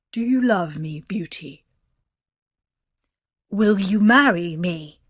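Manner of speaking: gruff beast voice, falling at the end. A narrator's reading. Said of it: "in a gruff voice".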